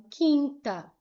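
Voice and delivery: quinta as kinta, silent U; correct pronunciation. Shown as a demonstration. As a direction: say quinta with a plain k. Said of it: "eu não pronunciei o U".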